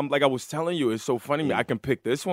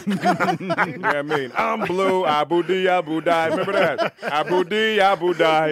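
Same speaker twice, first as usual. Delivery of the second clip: [name] impression; 5 semitones higher than usual. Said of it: "when I sound like [name]".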